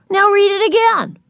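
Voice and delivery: put-on high-pitched little-kid demand. A narrator's reading. Little sister orders another reading, demanding.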